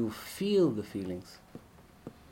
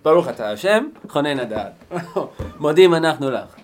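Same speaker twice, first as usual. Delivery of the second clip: loud empty recitation, no meaning; mock loud rote prayer; 12 dB louder, much faster than usual. Shown as a demonstration. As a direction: bellow the blessing mechanically.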